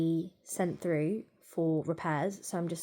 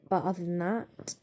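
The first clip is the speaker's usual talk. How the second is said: normal talking voice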